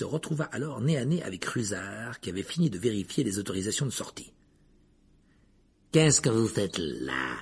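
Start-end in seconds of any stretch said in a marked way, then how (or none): 5.9-7.4 low, slow, menacing caretaker voice; suspicious. A narrator's reading. Caretaker catches Harry in the corridor, suspiciously. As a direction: drag the question out low with suspicion. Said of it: "demanda Rusard d'un air soupçonneux".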